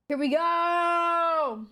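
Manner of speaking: high, very slow "here we go"; drawn-out cheer. Real, not acted. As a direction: stretch it out like a fanfare. Drawn-out exclamation.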